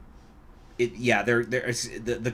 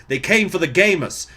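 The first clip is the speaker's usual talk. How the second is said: louder mock Alabama accent, rising high; hammed-up Alabama accent; 7 semitones higher, 9 dB louder than usual. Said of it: "I need to say it with the Alabama accent".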